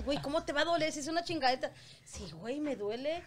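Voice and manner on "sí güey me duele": distant, off-mic voice; speaking away from the microphone; far from mic. Real, not acted. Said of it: "acércate más al micrófono".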